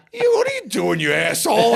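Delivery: high, loud retold scolding; angry father. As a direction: blow up like an outraged dad, for laughs. Imitates father scolding him.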